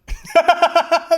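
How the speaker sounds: high loud burst of laughter. Real, not acted. Cracking up at the lion attack story, high burst.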